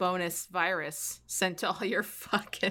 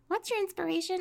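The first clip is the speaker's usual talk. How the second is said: mocking fan voice; 12 semitones higher than usual. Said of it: "and the first fucking question".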